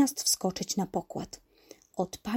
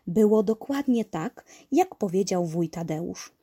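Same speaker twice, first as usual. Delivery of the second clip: ordinary delivery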